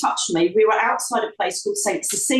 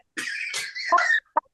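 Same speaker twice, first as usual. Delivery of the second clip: short unvoiced burst of laughter; 6 semitones higher, 4 dB quieter than usual. Real, not acted. Guest joking about her life plan, laughing. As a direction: laugh at your own joke.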